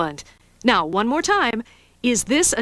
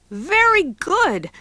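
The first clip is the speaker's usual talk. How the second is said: high, slow praise; 7 semitones higher, much slower than usual. Acted. Teacher praises the answer.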